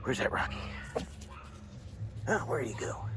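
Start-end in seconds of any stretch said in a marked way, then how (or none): 0.0-0.6 whispered coaxing. Real, not acted. Coaxing dog with treats.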